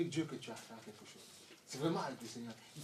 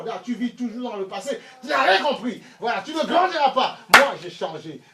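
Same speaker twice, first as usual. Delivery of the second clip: loud, higher sermon voice; full-force preaching; 7 semitones higher, 15 dB louder than usual. Acted. Preacher telling the congregation to leave the past, loud preaching. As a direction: press every phrase home.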